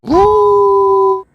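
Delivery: loud high-pitched whoop. Real, not acted. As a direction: yelp at the heat. Reacting to the spicy smell. (높고 크게 외치는 소리)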